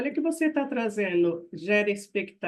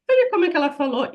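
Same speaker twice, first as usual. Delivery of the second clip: louder, quick huffy reading of a message; mock snippy reading; 5 semitones higher, 8 dB louder, much faster than usual. Colleague rereading a message with an imagined hostile tone. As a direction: read the text as if it were rude. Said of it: "e coloca uma entonação".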